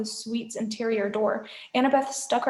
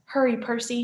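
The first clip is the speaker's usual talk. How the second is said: ordinary delivery, nothing marked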